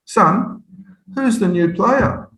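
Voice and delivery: high, slow, puzzled coach voice; drawn-out mock puzzlement; coach's question. Coach asking the captain about the new player, slow and puzzled.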